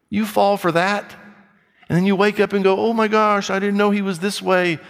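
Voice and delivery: a blunt rebuke to foolish daters; scolding rebuke. Real, not acted. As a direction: scold them to wake them up. Preacher scolds the congregation.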